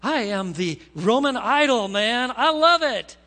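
self-adoring ruler. Acted. Herod lapping up the people's worship, mock self-adoration. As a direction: revel loudly in your own praise.

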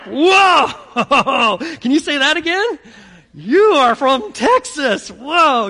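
loud, high amazed whoa with a laugh; surprised New Yorker. Retold or imitated. New Yorkers hearing a Texan accent, loud amazed whoa. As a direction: react as if you heard something exotic. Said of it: "They're like".

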